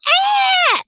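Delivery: high, loud shriek like a woman screaming; woman's scream imitated. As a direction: mimic a woman's scream far off in the trees. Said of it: "sounded like a woman screaming".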